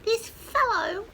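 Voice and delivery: high, loud chick voice; peeping chick. A narrator's reading. Smallest chicken feels cosy, high peep. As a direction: chirp it like a tiny chick. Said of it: "peeped the smallest chicken".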